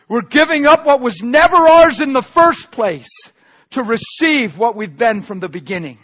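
loud, rising preacher's cry; fiery preaching. Real, not acted. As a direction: preach it hard to the congregation. Preaching at full force.

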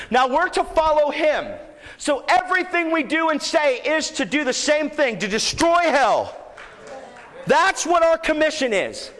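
high, driving sermon delivery; fervent preaching. Real, not acted. Preacher charging the church with its commission, impassioned preaching. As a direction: hammer the commission home to the congregation.

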